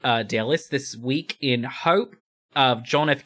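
careful, amateur Australian accent reading. Shown as a demonstration. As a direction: read the news as a would-be Australian. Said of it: "first paragraph in the Australian accent".

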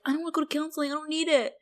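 high-pitched petulant protest; mock whiny refusal. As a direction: whine like a reluctant kid. Retold boy refusing counselling.

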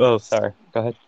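ordinary talk, nothing marked

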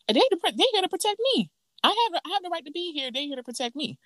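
high, hurried mock protest; high-pitched, agitated retelling; upset imitation. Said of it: "the girl's voice is shaking".

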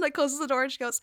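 ordinary talk

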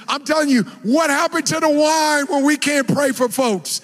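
fervent preaching to the congregation. Acted. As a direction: drive the point home. Preaching at full force.

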